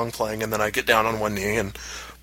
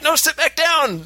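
frantic order; slower than usual. Retold or imitated. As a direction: snap her back into the booth. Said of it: "I'm like".

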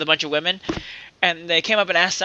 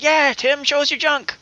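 mock heckling crowd; 8 semitones higher, slower than usual. Imagined crowd heckling Tim.

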